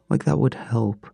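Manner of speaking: ordinary delivery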